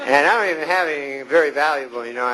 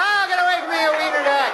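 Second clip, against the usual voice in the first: high startled cry, half laughing; a mock panicked shriek at a tiny dog; startled yell; 10 semitones higher than usual. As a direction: jolt awake and shoo the dog off in a squeal. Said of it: "When you wake up".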